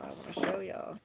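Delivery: normal talking voice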